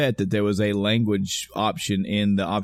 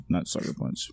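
sheepish whisper; 5 semitones lower, 8 dB quieter than usual. Real, not acted. Sheepish correction.

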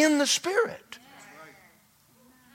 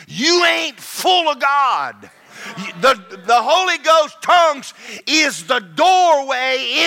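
fiery preaching; 4 semitones higher than usual. Acted. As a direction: drive it home like a revival preacher. Preaching hard about praying.

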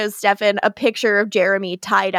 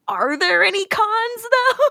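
mock innocent; 10 semitones higher than usual. Catherine quoted, scheming.

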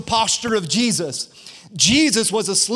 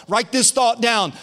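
usual speaking voice

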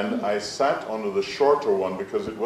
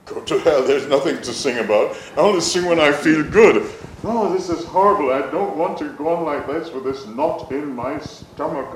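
higher and louder, fed up and protesting; angry retort; 5 semitones higher, 5 dB louder than usual. Retold or imitated. Boy snapping back at his inner voice, angry. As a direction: push back hard at the voice.

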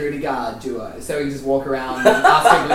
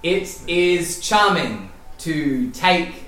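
slow, deliberate debate opener; slow formal opening; much slower than usual. Acted. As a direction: start the statement grandly. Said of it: "opening statement, x0.4".

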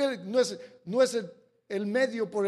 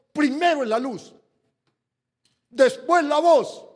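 loud and higher, driving on; fervent preaching; 5 semitones higher, 10 dB louder than usual. Real, not acted. Pastor pressing the congregation, loud preaching. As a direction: preach it hard to the pews.

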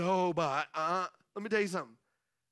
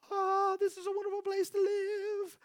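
higher sugary happy-home voice; sweet sing-song; 10 semitones higher than usual. Retold or imitated. Family praising home before biscuit fight, sugary. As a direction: gush like everything is perfect.

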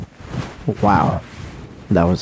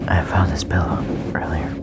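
ASMR whisper; 2 semitones lower than usual. Pretend. Whispering to ASMR listeners about stereo sound. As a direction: hush it close to the mic.

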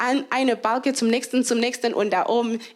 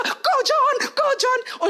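cheering; 12 semitones higher, slower than usual. Retold or imitated. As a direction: cheer a runner on from the stands. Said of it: "ihr seid alle so". (lauter Anfeuerungsruf)

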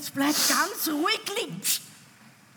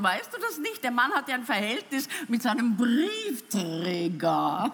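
ordinary talk, nothing marked

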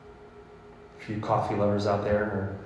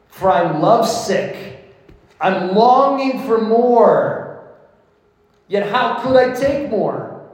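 fervent love-poem reading; 6 semitones higher, 5 dB louder than usual. Acted. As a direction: pray it out like a lovesick bride.

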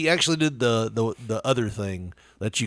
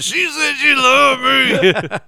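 very slow, much higher gravel-free mock Clint line; Clint impression; 13 semitones higher, 6 dB louder, much slower than usual. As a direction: drag it out like a movie cowboy. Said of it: "I was just saying it with Clint".

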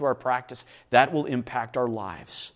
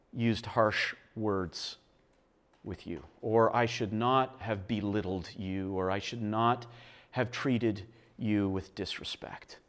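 much softer, slow and earnest; sincere confession; 6 semitones lower, 10 dB quieter than usual. Shown as a demonstration. Pastor models a real apology to his spouse, hushed and slow. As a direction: confess each wrong deliberately, humbly. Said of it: "but to say".

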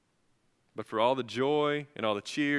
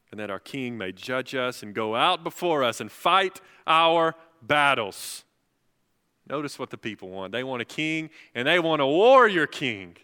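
preaching climax; 6 semitones higher, 11 dB louder than usual. Acted.

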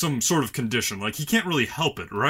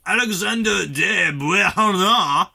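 French accent; 3 semitones higher than usual. Shown as a demonstration. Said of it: "You got that French accent still going".

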